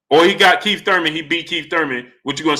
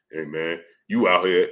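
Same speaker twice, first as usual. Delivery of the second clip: low, softer mock of a bold talker; chest-out imitation; 10 semitones lower, 5 dB quieter than usual. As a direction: drop your pitch and imitate his chest-out swagger. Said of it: "said it with his chest out".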